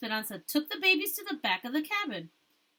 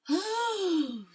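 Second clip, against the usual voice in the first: higher, falling sigh of release; long sigh of relief; 8 semitones higher than usual. Acted. Esperanza relieved after the long day, a long sigh. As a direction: let all the worry out in one long sigh. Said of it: "let out a long sigh of relief".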